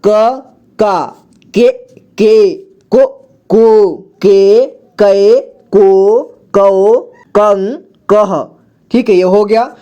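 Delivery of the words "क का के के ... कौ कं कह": slow क series, each syllable apart; syllable drill. Shown as a demonstration. Teacher shows learners how to read क with vowel signs, slow recitation. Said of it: "इसको fast वैसे ही पढ़ते चलते हैं".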